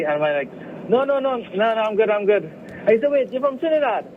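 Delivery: ordinary talk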